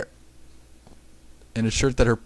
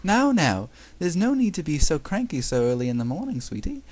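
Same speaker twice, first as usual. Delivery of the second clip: higher-pitched, put-on British nanny; British accent; 6 semitones higher, 4 dB quieter than usual. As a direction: coo it in an attempted British accent. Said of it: "trying to get a British accent".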